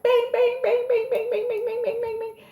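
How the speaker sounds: high pinging notification sounds; ping it like a phone going off; imitating her phone buzzing all night.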